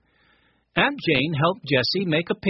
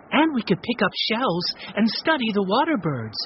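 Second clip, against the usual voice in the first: ordinary delivery, nothing marked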